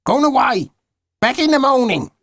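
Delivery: high, put-on voice of a grumpy old neighbour; old geezer voice. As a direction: play the gruff old man next door. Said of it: "said the old geezer next door".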